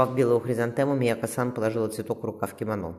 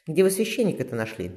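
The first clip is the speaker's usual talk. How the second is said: higher, wondering question; surprised question; 6 semitones higher, 3 dB louder than usual. A narrator's reading. Theo to Vasilisa, looking round the street, surprised. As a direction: ask it amazed at the tidy village. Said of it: "удивлённо спросила Тео".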